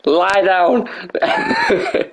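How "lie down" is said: high, firm order as if to a dog; dog command. Pretend. Streamer talks to game wrestler like his dog, commanding. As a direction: give it like a command to a pet. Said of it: "gonna talk to you like my dog".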